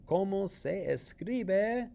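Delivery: slow, high quiz question; asking the question. Shown as a demonstration. Teacher modelling the question for students, slow and high. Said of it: "if I'm asking you".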